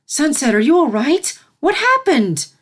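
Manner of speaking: high, loud anxious questions; frantic concern for the filly; worried princess. A narrator's reading. Celestia rescuing the filly.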